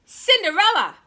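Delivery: loud, high summoning call. Acted. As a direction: bark the name up the stairs. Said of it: "cried the stepmother".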